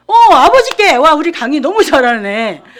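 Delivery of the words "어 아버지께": high, loud enthusiastic answer; loud call. Real, not acted. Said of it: "calling out answer". (아이들에게 크게 외치듯)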